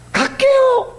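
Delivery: high, put-upon reply; imitating the harried wife; wife answering. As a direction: answer back wearily.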